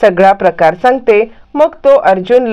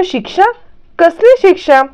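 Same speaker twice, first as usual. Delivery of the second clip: retold surprise, pitched much higher; high, puzzled questioning in a retold exchange; surprised questioning; 10 semitones higher than usual. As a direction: echo it as a high, startled question. Arjun reacts to news of punishment.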